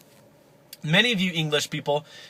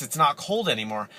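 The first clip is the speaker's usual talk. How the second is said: ordinary delivery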